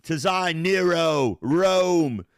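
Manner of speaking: slow, loud, hammed-up emperor; dark lord. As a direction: proclaim it like a pompous tyrant. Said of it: "he's holding a crouton".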